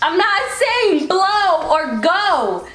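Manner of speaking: high, slow, word-by-word correction; exasperated yelling. Real, not acted. Player correcting friend's wrong guesses, exasperated yell. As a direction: raise it and stretch every word, half laughing. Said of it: "I know you're not yelling at me".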